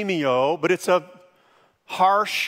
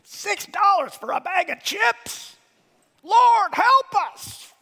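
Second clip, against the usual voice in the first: ranting at prices; 14 semitones higher than usual. Retold or imitated. Shopper railing at grocery prices in a high outraged voice. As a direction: squeal in fake disbelief at the price of chips. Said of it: "I just remember going".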